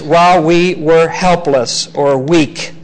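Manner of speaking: slowed, each word weighted; a slow, stressed scripture line; emphatic reading. Shown as a demonstration. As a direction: read it out with weight. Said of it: "it's very emphatic".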